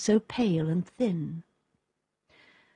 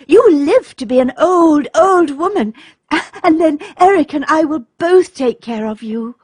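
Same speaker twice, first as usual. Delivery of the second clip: put-on high, louder voice of a young daughter; eager little girl; 8 semitones higher, 7 dB louder than usual. A narrator's reading. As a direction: answer brightly as the little girl. Daughter reassures her mother.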